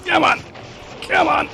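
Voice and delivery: rally yourself, voice jumping up; urgent high call. Real, not acted. Gamer egging on the monster mid-fight, calling high and urgent.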